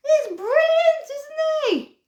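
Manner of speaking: excited praise. Real, not acted. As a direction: show it off with a high thrilled voice. Showing off finished dog craft.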